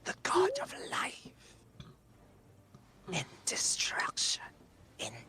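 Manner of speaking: whispered, high demonic entity. Acted. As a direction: breathe it like an ancient demon. Demon voice held.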